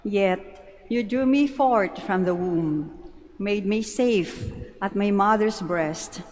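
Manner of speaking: normal talking voice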